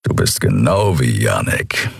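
low rumbling grumble; grumbling Kero. A narrator's reading. Kero envying Endriel's stubbornness, low grumble. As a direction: rumble it out deep in the chest.

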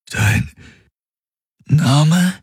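pained, strained question; tormented. A narrator's reading. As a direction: repeat it in torment. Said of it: "wiederholte er gequält".

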